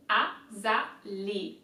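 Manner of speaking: Azalée spoken slowly, final E clear; slow demo. Shown as a demonstration. Teacher slowly modelling azalée for viewers. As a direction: drawl Azalée for learners. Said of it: "so it's gonna be E".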